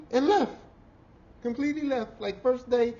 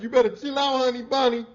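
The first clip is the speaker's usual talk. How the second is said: louder, higher chill-out warning; retold yell; 8 semitones higher, 8 dB louder than usual. To a coworker, a raised chill-out warning. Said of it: "That's how it was".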